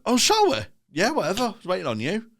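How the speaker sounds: high, breezy mock of the promoter. Comic mimicking the promoter who forgot the time. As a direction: toss it off like a clueless promoter. Mocking the promoter's casual reply.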